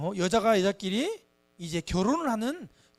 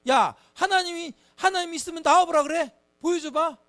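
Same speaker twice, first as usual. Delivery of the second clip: mock defiant taunt; 7 semitones higher, 6 dB louder than usual. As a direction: dare God to show up, playacting a scoffer. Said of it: "이러면서 하나님을 막 미워하고요".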